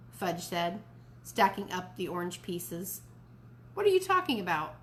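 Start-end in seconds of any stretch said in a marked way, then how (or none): 3.7-4.7 surprised. A narrator's reading. Dad surprised by accusation.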